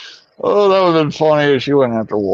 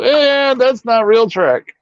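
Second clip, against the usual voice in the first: higher, slow mocking brush-off; mock dismissive fan; 11 semitones higher, slower than usual. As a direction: sneer it off like a snob. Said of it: "Just go".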